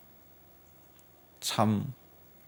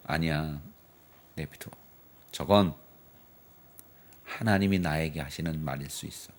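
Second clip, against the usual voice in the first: calm restraint; 11 semitones lower, 8 dB quieter than usual. Retold or imitated. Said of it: "다윗이 뭐라 그래요?".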